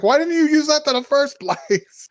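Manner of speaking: high-pitched incredulous demand; mock exasperated question. Brother questions sister's game version, high exasperation. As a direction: shoot up high, ending in a laugh.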